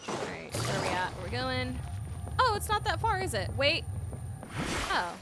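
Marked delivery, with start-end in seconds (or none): none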